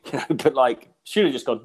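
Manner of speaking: ordinary delivery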